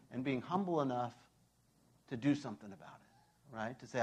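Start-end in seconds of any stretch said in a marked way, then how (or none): none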